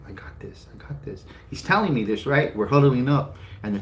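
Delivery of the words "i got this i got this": whispered, hushed I got this; whispered reassurance. Retold or imitated. Dad huddling with his son, whispering reassurance. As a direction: tell your son softly so the teacher can't hear.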